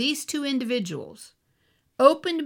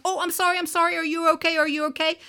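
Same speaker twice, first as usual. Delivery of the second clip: high, rushed, imitated apologising; flustered apology; 6 semitones higher, much faster than usual. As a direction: imitate someone apologising over and over, pitched up. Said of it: "She's all apologetic".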